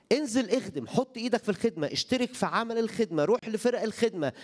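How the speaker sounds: high, urgent preacher's call to serve; fervent preaching. Acted. Preacher urging the church to serve, high and fervent. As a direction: push the congregation to get up and serve.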